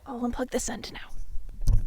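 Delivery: slow whisper to herself. Real, not acted. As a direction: whisper it while fiddling with cables. Muttering while fixing mic.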